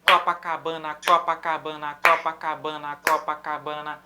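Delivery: "Copacabana" chanted in five even syllables, low monotone loop; cinque note uguali. Shown as a demonstration. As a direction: fit "Copacabana" evenly into each beat, accent on the first syllable. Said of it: "Marcar andamento com a mão".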